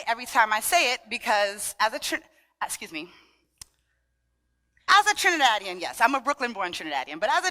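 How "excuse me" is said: usual speaking voice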